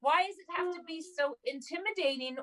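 exasperated. Retold or imitated. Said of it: "And I'm like".

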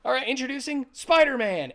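loud, high ring announcer; announcer call. Retold or imitated. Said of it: "He's the wrestling announcer".

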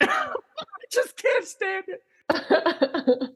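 real helpless laughter, high and drawn out; breaking down laughing. Real, not acted. Co-host losing it over a fart joke, helpless laughter. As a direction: squeal the words out slowly through laughter. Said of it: "immediately break down".

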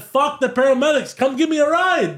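very high, insistent outburst; exasperated demand. Retold or imitated. Crash victim demanding a ride from cousin, exasperated.

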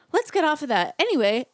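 high, loud chirpy host voice; brightly change the subject on air; perky TV host. Retold or imitated. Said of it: "they're all".

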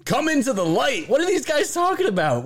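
high mocking parody voice; hammed-up high parody. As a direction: mimic them in a high sneer. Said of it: "You've got to be kidding me".

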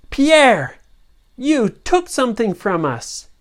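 high, loud accusation; accusing call. Acted. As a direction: call out the thief. Family confronts pirate.

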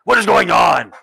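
loud, high outraged demand; booming Duke. Acted. Duke stands up from his chair, booming at the brawl. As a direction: thunder it across the hall. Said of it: "the booming voice of the new Duke".

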